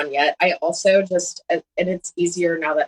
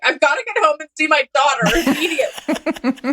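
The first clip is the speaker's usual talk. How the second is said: high, laughing plea; a high-pitched giggling excuse to a cop; 12 semitones higher than usual. Retold or imitated. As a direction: laugh while pleading. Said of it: "And I'm like".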